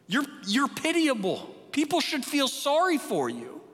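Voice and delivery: fervent preacher's rebuke, pitched high; preaching at full force. Acted. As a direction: thunder it from the pulpit like a prophet.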